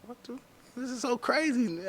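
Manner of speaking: high, hushed disbelief; hushed and high, like he can't believe it; stunned inner thought. Retold or imitated. In the unmarked van, telling himself in high hushed disbelief. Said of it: "I'm like".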